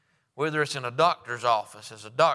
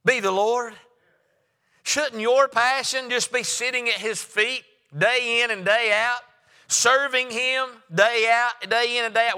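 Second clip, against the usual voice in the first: raised, rousing sermon voice; preaching; 9 semitones higher, 4 dB louder, slower than usual. Acted. Preacher urging the church toward passion for God, rousing. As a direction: preach it up to the congregation.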